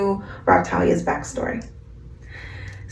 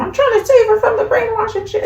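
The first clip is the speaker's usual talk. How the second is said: mock villain; faster than usual. Sister's retold excuse.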